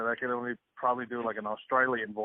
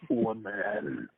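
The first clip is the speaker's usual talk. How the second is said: movie guy voice; 9 semitones higher than usual. Shown as a demonstration. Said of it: "it just sounds like this".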